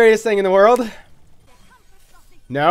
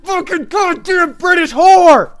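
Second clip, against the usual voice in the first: high, loud, slow raging yell; furious shout; 12 semitones higher, 8 dB louder than usual. Real, not acted. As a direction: scream your fury at the enemy. Raging at enemy Tracer.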